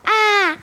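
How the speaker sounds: high "Ah!" cry, acted out; demonstrated scream. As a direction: show how you screamed. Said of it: "à crier comme ça".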